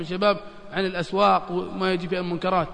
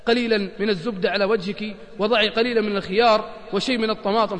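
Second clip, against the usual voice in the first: ordinary talk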